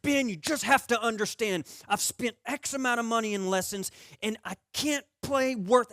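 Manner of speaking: high-pitched, exasperated venting; retold angry rant. Golfer venting to his buddy about costly lessons, mock-angry. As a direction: rant at your buddy in comic frustration. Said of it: "I got so angry".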